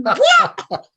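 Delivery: high, loud laughing yelp of disbelief; retold bafflement bursting into laughter; incredulous. Laughing disbelief at sleep talk.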